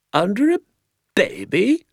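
high, incredulous echo; astonished. Acted. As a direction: repeat it in high-pitched disbelief. Doctor astonished by the hiding place.

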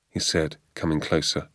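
usual speaking voice

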